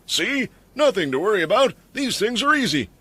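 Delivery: smug, high and pleased; self-satisfied. A narrator's reading. Bowman reassuring the group, smug. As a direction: gloat with a grin. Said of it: "with a self-satisfied grin".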